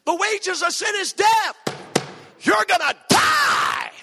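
high, full-force pulpit yell, drawn out; hammed-up hellfire shouting; preacher shouting. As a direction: shout it like a novice copying preachers. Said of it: "and so I shouted".

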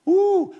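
sudden high, loud "woo!"; excited whoop. Acted. Person overexcited while saying grace in public, excited whoop. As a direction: burst out with a whoop mid-prayer.

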